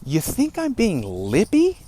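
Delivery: mock outrage at being called lippy; indignant retort. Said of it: "I said".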